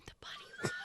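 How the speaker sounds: excited high whisper; breathless high hushed whisper. Acted. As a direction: kids whispering in awe. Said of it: "everybody's just whispering".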